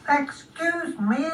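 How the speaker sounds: high, slow snail call; small drawn-out snail voice. Acted. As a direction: ask for attention as a tiny snail. Snail calls to Spot again.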